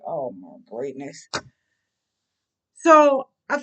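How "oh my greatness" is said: much lower and softer, deflated; dismayed sigh. Retold or imitated. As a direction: say it to yourself in dismay. Dismayed aside.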